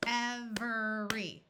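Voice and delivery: Every drawn out slowly, ev-er-y, three syllables; careful three syllables. Shown as a demonstration. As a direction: stretch Every into ev-er-y. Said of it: "Listen carefully".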